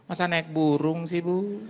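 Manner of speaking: put-on high, slow old lady voice; lightly voiced elderly widow; old woman caller. Old widow phoning the pastor, high and drawn out.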